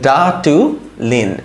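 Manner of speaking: ডাটুলিন very slowly, in separated syllables; slow sounding-out. Shown as a demonstration. Teacher repeating the new word for learners, slow sounding-out. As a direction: stretch DAA-TU, pause, then LIN. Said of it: "তাহলে কিরকম হয় এই পুরা".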